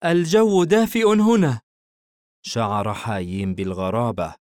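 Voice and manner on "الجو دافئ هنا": put-on high, cozy little boy; small child snug in bed; content child voice. A narrator's reading. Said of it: "استلقى الطفل في الفراش وقال".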